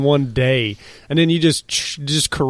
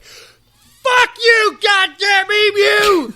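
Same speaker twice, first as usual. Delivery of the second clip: furious shout; much slower than usual. Retold or imitated. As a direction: scream at the emu in rage. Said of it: "Can you imagine these soldiers' frustrations".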